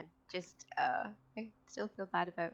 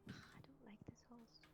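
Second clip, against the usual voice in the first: whisper; 22 dB quieter than usual. Real, not acted. Reluctant about hitting friend with horse.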